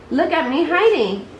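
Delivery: put-on a light childlike voice, playful; little Roo voice. A narrator's reading. Roo calling to friends from a box, playful child voice. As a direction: play it as small Roo hiding.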